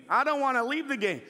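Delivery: ordinary delivery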